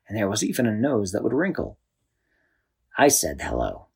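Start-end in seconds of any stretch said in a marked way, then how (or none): 3.0-3.9 a lightly deep little mayor; balloon mayor voice. A narrator's reading. Balloon mayor speaks first time.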